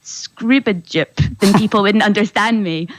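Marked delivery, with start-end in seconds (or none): 0.0-1.4 nonsense "scroobajup" in place of "running", drawn out slowly; made-up word "scroobajup", stretched out as a demo; deliberate mispronunciation. Shown as a demonstration. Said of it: "if I pronounce the word running like".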